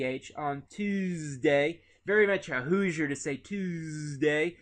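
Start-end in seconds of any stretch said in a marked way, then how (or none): 0.4-1.8 "Tuesday" with a long buzzing Z; Hoosier Z sound. Shown as a demonstration. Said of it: "make an S sound like a Z". 3.4-4.6 "Tuesday" with a drawn-out, buzzing Z; Hoosier Z sound. Shown as a demonstration. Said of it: "make an S sound like a Z".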